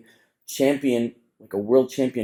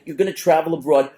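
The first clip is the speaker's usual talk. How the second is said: emphatic warning; 6 semitones higher, 3 dB louder than usual. Retold or imitated.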